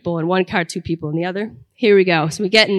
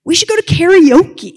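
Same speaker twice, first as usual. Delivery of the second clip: imitating her bright idea, higher and louder; excited suggestion; 9 semitones higher, 6 dB louder than usual. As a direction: pitch the idea up high and loud, mock-eager. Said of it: "she has this great idea".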